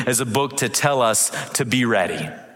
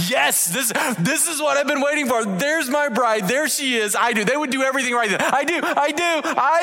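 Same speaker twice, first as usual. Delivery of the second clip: much higher, sing-song eager groom; overjoyed groom; 12 semitones higher than usual. Retold or imitated. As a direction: gush like a groom seeing his bride. Groom voiced overjoyed, high.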